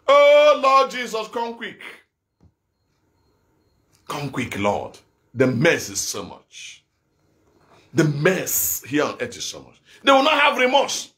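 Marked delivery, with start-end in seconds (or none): 0.1-1.8 anguished plea to God. Real, not acted. Preacher cries out to God, fading. 4.1-9.8 very low, hushed lament; grieving prayer. Real, not acted. Grieving prayer over the mess.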